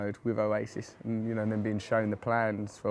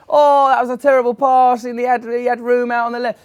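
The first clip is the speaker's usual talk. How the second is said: high, loud broadcaster delivery; commentator voice; 12 semitones higher, 14 dB louder than usual. Pretend. Commentator repeating the fan, high and loud. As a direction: boom it like TV commentary. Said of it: "the commentator goes".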